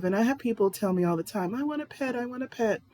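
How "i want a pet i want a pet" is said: whiny begging. Retold or imitated. Mocking people who beg for a pet.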